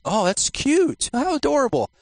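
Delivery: high-pitched gushing over something cute; mock cooing. Preacher mocks people admiring cute angels, high cooing.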